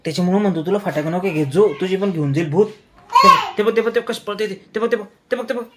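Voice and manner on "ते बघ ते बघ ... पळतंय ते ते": sudden high loud ते बघ, playing scared; mock alarm. Father teasing his child over a ghost clip, mock alarm. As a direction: shout as if you just spotted the ghost.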